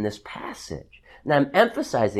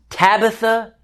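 raised-pitch summons falling to a measured command; retold command; 5 semitones higher than usual. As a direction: call out the name high, then drop for the order. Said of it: "and he commands her".

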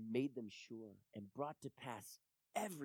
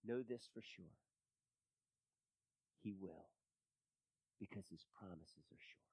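soft, low preacher's reassurance; hushed assurance; 5 semitones lower, 9 dB quieter than usual. Real, not acted. Preacher assuring the doubting congregation, hushed assurance. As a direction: drop right down and assure them quietly.

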